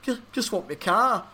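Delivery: mock-forlorn plea, lightly acted; longing. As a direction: pine for it like a lonely man. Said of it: "longingly into the night, going".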